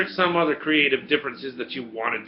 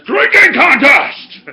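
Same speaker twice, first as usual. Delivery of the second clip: high, drawn-out shout; booming declaration; 13 semitones higher, 13 dB louder, much slower than usual. Acted. Thor at the bar challenges all to drink, booming shout. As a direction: bellow it out like a challenge to the whole bar. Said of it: "and declares".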